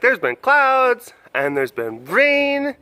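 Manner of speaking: high, loud, slow pretend cat voice; voicing the cat. Keeper speaking for the cat about wet toes, high slow pretend voice. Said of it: "She's like".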